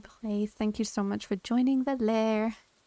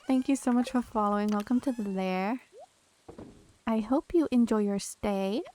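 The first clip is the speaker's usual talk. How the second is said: normal talking voice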